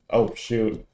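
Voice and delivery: ordinary delivery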